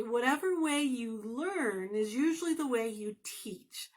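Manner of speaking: usual speaking voice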